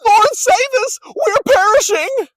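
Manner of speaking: very high, loud terrified cry for help; panicked cry. A narrator's reading. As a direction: scream it as the boat sinks. Disciples wake Jesus in storm.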